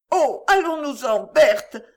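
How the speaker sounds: high, loud horrified plea; horrified cry. A narrator's reading. Monique urging her sister to flee the snake, horrified cry. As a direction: beg to flee in horror. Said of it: "s'écria Monique, en tendant, l'air horrifié".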